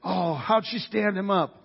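mock angry guests. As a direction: puff up in mock outrage. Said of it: "sweating and angry".

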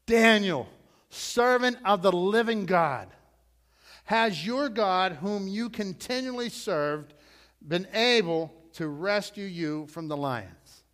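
loud, slow, anguished cry from the king; cried out in anguish. A narrator's reading. King crying out to Daniel in the den, loud anguish. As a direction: call out to Daniel in anguish, drawn out. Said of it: "he cried out in anguish to Daniel".

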